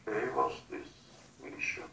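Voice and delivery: ordinary talk, nothing marked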